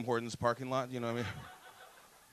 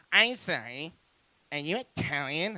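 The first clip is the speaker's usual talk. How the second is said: put-on voice of a woman cutting in; imitated woman interrupting; 3 semitones higher, 3 dB louder, slower than usual. Woman cuts off stranger to ask his background, imitated. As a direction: cut in with a put-on questioning voice.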